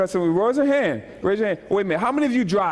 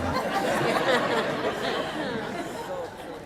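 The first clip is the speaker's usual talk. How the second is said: soft laugh; 5 semitones higher, 6 dB quieter than usual. Real, not acted. Laughs after chiding congregation.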